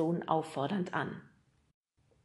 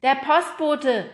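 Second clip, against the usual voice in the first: loud, high, drawn-out call; calling back; 7 semitones higher, 10 dB louder, slower than usual. A narrator's reading. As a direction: shout the answer back through the house. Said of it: "rief Nemo zurück".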